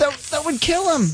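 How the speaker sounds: high, stammering alarm; horrified. Acted. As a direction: protest in shock. Nurse horrified at cat's demand.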